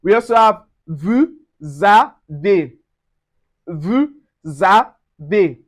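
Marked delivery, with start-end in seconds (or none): 0.9-2.7 "vous avez" as voo-za-vay; S of "vous avez" linked as Z; linked pronunciation. Shown as a demonstration. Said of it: "The S also changes to Z". 3.7-5.6 "vous avez" as voo-za-vay; S of "vous avez" linked as Z; linked pronunciation. Shown as a demonstration. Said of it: "The S also changes to Z".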